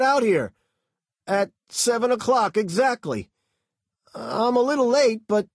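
high, loud nervous delivery man; high-pitched, loud, frightened talk; scared driver. A narrator's reading. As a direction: babble the explanation in fear. Driver voiced throughout.

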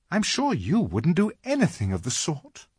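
high, earnest Lucy; reassuring little girl. A narrator's reading. Lucy sure the faun would never, reassuring. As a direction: insist in a high girl's voice.

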